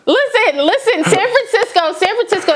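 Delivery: a real burst of exasperation; exasperated. Real, not acted. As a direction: demand attention, worked up. Exasperated outburst on air.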